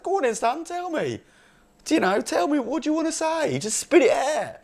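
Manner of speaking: very high strained impatient voice; impatient demand. Retold or imitated. Son urging his dad at Christmas dinner, very high impatient voice. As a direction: squeak it out, dying to know. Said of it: "So I said".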